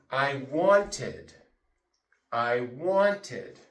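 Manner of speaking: "wanted" said as want-ed, a clear extra syllable. Shown as a demonstration. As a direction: sound out "wanted" with its last syllable. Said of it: "We say".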